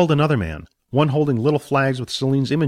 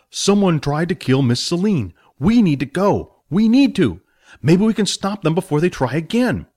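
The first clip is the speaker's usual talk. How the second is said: put-on pleading young hero; 7 semitones higher, 3 dB louder than usual. Billy pleads to go.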